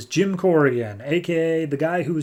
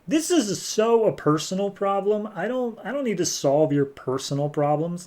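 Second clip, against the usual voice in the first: higher-pitched mock exasperation; acted annoyance, pitched up; exasperated refusal; 7 semitones higher than usual. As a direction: brush them off, fed up. Hero refuses to help, annoyed.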